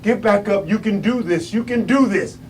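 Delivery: soft, urgent words to himself; imitated self-talk. Floored boxer urging himself back up, quietly. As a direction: quietly coach yourself up. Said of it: "he was saying to himself".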